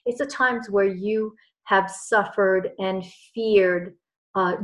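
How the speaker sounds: ordinary talk, nothing marked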